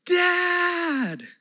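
put-on high, bright call of a child; excited kid. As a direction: shout it like a kid at the door. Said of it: "you know how they would respond".

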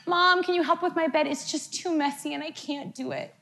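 put-on high, sweet little-kid plea; lightly voiced child asking for help; imitating sweet child. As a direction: ask sweetly like a small child. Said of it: "kindly says to me".

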